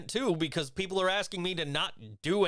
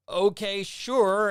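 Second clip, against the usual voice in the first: reluctant agreement; 6 semitones higher, 6 dB louder, much slower than usual. Retold or imitated. Acted grudging reply to client request.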